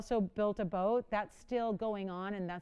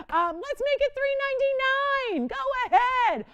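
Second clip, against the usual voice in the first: excited; 8 dB louder than usual. Real, not acted. Excited price drop on shopping show.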